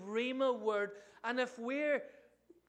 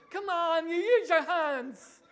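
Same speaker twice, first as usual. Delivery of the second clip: loud, high mock-Italian exclamation; passionate Italian; 7 semitones higher, 10 dB louder, slower than usual. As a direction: ham up an impassioned Italian, drawing it out. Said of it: "When you're passionate".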